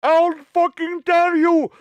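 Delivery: put-on very high, loud, slow accented yell; hammed-up accented scream; mock Indian accent shout. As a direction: drag it out screaming like the clerk. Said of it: "yelling at me".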